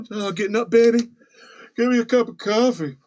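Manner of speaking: high, put-on husband voice; comic high-pitched waking-up voice; casual husband. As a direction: play a blithe spouse getting up. Acts husband casually getting up.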